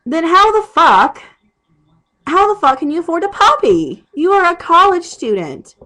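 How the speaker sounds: furious ranting at a friend; angry rant. Real, not acted. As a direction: yell it in disbelief at her choice. Ranting at roommate over puppy.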